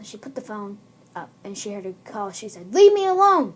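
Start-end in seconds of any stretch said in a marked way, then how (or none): 2.7-3.5 high, loud outburst; acted yell. Girl to creepy caller, acted high yell.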